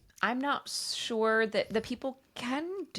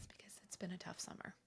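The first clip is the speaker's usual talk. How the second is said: fast whispered aside; whispering; 24 dB quieter, faster than usual. Real, not acted. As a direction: whisper it quickly under your breath. A quick joking aside.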